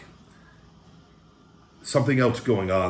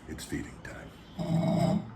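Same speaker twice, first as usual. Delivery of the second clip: slow, softer breathy whisper; mock-ominous whisper; 3 semitones lower, 7 dB quieter, much slower than usual. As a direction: whisper it like a creepy announcement. Announcing the meal.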